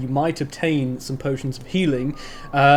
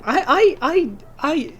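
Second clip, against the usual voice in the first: put-on much higher, gushing girly voice; high girly half-orc. Half-orc blacksmith gushes at the bard in a high girly voice. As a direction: gush like an excited schoolgirl.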